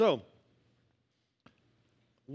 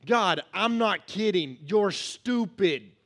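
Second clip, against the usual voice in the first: louder, slow, exasperated rant at God; indignant complaint; 2 semitones higher, 5 dB louder, much slower than usual. Retold or imitated. Habakkuk complaining to God, loud and slow. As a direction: demand answers in slow outrage. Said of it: "Chapter two".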